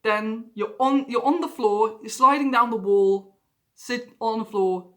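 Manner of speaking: normal talking voice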